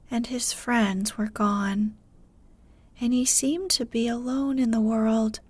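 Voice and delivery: ordinary delivery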